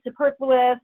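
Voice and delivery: usual speaking voice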